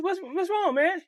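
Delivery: put-on young boy asking his friend why he stopped; worried kid. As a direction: ask like a scared little kid. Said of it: "I said".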